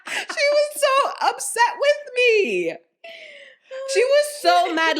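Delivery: high laughing talk; breaking into laughter mid-story; laughing hard. Real, not acted. Laughing through retelling.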